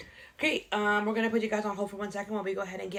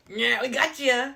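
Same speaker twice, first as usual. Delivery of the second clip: slow, louder, hammed-up gotcha; mock-triumphant, higher and stretched; cheesy gotcha; 4 semitones higher, 5 dB louder, slower than usual. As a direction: crow it cornily, drawn out. Mock gotcha, called cheesy after.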